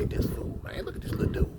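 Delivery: hushed, voiceless scoffing; whispered mockery. Cellie whispering at the video about the little thief, mocking. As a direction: breathe it out like a secret joke.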